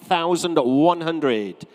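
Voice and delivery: brisk, projected auctioneer calling bids; auctioneer's patter. Real, not acted. Auctioneer to room and online bidders, selling at twelve hundred, projected patter. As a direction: work the room for bids, drawing out the sale.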